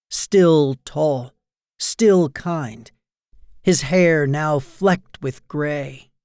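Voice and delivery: plain reading voice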